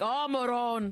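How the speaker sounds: loud mock "Oh, moron" from a teasing dad; hammed-up fatherly teasing. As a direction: boom it out like a dad ribbing his son. Dad teasing son's grades.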